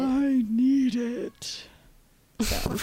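slow, drawn-out cartoon voice; character voice. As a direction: stretch it out like a cartoon. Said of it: "I'm just doing a little character work".

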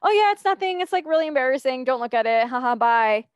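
high, loud and rushed, nervous deflection; flustered brush-off. Retold or imitated. Brushing off a friend asking about her TikTok, high and flustered. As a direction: laugh it off fast, flustered and embarrassed. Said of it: "I would just get so flustered".

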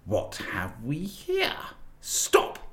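slow, cold witch halting the sledge; the witch barks a halt; witch's command. A narrator's reading. Witch spots the feast and orders the sledge to stop, cold and slow. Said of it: "the witch said".

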